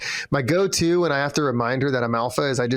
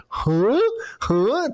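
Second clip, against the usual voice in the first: confused dog voice; 11 semitones higher than usual. Retold or imitated. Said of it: "This one is like".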